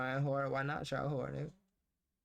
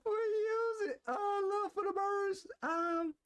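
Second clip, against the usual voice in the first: high mock-wailing confession; laugh-wail it like a guilty confession. Mock confession of negative habits.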